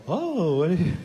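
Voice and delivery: lightly voiced, falsely encouraging; mock sweet praise. Dad praising baby's diaper, sugary and patient. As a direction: humor the baby with fake delight. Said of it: "you just go".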